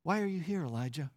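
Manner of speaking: barely above a breath, gently; still small voice. Retold or imitated. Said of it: "in that still small voice".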